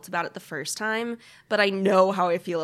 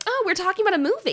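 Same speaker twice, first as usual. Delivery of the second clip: surprised; 12 semitones higher, 5 dB louder, faster than usual. Retold or imitated. Mimicking her own surprise at segues.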